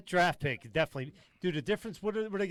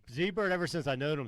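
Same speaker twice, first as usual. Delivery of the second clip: normal talking voice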